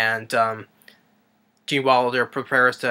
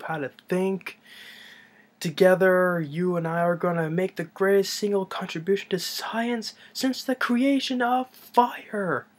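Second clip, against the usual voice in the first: imitated proclamation, climbing steadily higher; grandiose scientist speech; 7 semitones higher than usual. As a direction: build from hushed promise to high, drawn-out climax. Mad scientist's speech building to climax.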